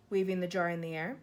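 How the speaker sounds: plain reading voice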